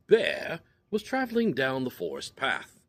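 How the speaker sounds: usual speaking voice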